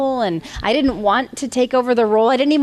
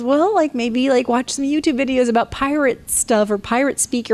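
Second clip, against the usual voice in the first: friend's voice; 6 semitones higher than usual. Retold or imitated. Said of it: "she goes".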